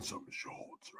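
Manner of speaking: slow unvoiced whisper; cheeky hushed aside. Real, not acted. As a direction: say it on the quiet. Streamer whispers opinion.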